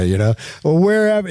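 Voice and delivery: ordinary talk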